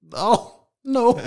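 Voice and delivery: high, loud embarrassed oh no; sheepish oh no. Retold or imitated. Admitting to the mother he overpaid, high and sheepish. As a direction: caught out, squeak it.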